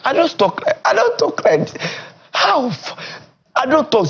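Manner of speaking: high-pitched insistent denial; indignant protest. Real, not acted. Guest denying his speech is an act, indignant.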